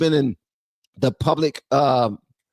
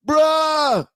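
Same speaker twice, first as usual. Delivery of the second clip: excited outburst; 11 semitones higher than usual. Real, not acted. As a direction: blurt it out, floored by what you just heard. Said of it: "for seeming so freaking excited".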